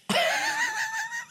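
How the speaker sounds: high laugh. Real, not acted. Laughs at virginity story.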